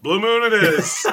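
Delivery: laughing, high and slow. Real, not acted. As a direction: laugh while saying "Blue Moon it is" high and slow. Echoing the verdict, laughing.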